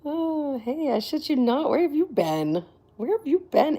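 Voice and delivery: high, bright hello to a returning fan; excited greeting. Real, not acted. Streamer greets returning viewer McGilla, excited and high.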